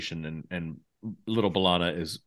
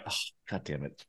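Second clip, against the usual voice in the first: muttered curse; 8 semitones lower, 13 dB quieter than usual. Retold or imitated. Retold reaction to the hot trailer.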